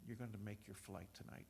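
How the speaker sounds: very soft, low voice of the Lord; whispered reply. Retold or imitated. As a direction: answer in a deep hush, barely projected. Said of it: "the Lord whispered back".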